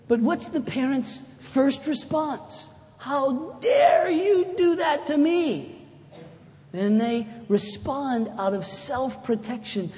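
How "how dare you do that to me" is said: high, slow, outraged cry of a hurt parent; indignant shout. Retold or imitated. Parent's angry first response.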